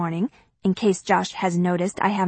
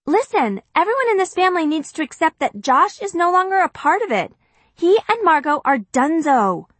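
put-on raised, insistent teen voice; firm announcement; 8 semitones higher, 3 dB louder than usual. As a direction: lay down the law to the family.

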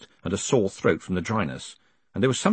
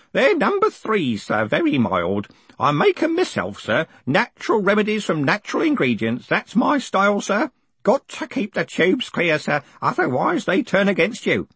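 put-on high-pitched old man, chatty about his remedies; old postal worker, eager; 10 semitones higher than usual. Grote offers his homemade lozenges, high and eager.

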